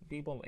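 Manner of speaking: "People" said as "beeble", voiced B's; mistaken B for P. Shown as a demonstration. Teacher repeating the wrong People, B for P. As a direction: say "People" wrongly, with B in place of each P. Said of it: "غلط".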